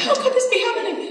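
very high, incredulous plea; high-pitched comic disbelief; mock lament. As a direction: wail the question in playful despair. Mock questioning of Providence.

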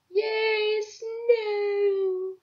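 high, bright squeal of delight; excited squeal. Pretend. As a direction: squeal with joy at the snow. Excited about filter snow.

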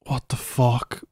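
whispered in real disbelief; stunned whisper. Real, not acted. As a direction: whisper it, stunned, barely any voice. Stunned reaction to the show.

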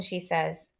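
ordinary delivery, nothing marked

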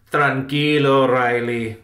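emphatic calming command; firm order. A narrator's reading. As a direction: order him to calm down, loudly. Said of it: "ordered Salvador".

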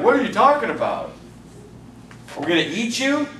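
lightly voiced bafflement, ending in a question; mock perplexed. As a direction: imitate confused listeners questioning the speaker. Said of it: "they're perplexed".

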